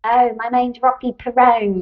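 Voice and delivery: playful put-on Australian; Australian accent. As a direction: try out an Aussie accent for fun. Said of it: "that's better than mine".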